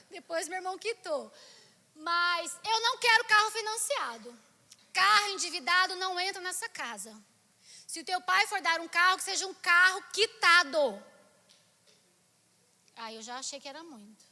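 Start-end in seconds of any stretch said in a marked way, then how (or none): 1.9-11.0 loud, emphatic retold refusal; mock maternal scolding; stern mother. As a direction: lay down the law of the house. Mother lays down her rule.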